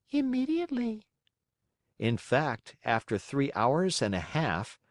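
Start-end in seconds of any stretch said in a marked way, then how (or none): 0.1-1.1 put-on a calm old snail calling down. Snail replies.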